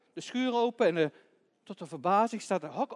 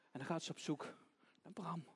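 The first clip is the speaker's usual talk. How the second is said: usual speaking voice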